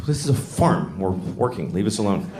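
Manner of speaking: gruff brush-off. Acted. Farmhands brushing Dorothy off.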